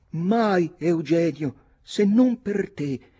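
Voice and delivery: high, loud lovestruck declaration; high girl's voice, drawn out at the end; ardent young lady. A narrator's reading. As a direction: vow it like a theatrical heroine. Nora swears love to the captain.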